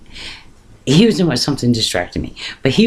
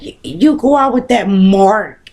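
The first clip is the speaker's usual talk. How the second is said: put-on high, scornful sneer at the boyfriend; mocking disbelief; 7 semitones higher, 4 dB louder than usual. Gang boy sneering at her boyfriend, high and scornful. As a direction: scoff at her choice, pitched up.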